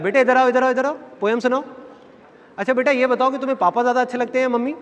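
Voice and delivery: put-on high singled-out call to a child; coaxing relative. Relatives calling kids over to entertain them, coaxing. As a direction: imitate an auntie cajoling a kid.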